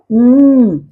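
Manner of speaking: "उम" as a sustained mmm hum; closed-lip M sound. Shown as a demonstration. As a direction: close both lips and hum "उम". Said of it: "बंद करके आवाज निकालेगा". ("उम" बंद होंठों से म्म्म)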